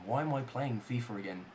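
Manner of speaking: soft, low, weary self-questioning; deflated grumble. Real, not acted. Gamer asking himself why he plays, deflated grumble. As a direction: ask it quietly to yourself, deflated.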